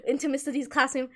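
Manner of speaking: normal talking voice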